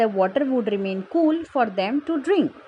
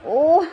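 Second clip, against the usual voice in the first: higher groan of a suffering pitcher; pained groan; 4 semitones higher, 4 dB louder than usual. A narrator's reading. Pitcher groans over an aching stomach, pained groan. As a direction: moan "oh" in pain. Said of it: "the pitcher groaned".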